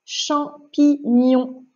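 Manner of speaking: slow, syllable by syllable; word dictation. Shown as a demonstration. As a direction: dictate "Champignon" with a gap between syllables. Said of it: "on va coder des mots".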